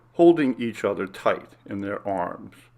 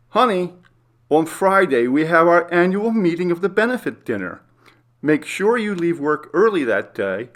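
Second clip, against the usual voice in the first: higher, louder playful woman's voice; flirty wife; 7 semitones higher, 4 dB louder than usual. Acted. Wife reminding husband about the benefit dinner, playful flirt. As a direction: tease him sweetly like a flirting wife. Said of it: "Jane said, smiling".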